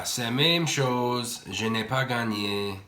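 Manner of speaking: slow, deliberate French, savouring each word; speaking French. Real, not acted. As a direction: say it slowly in French, like showing off. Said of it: "I don't know why I'm talking French".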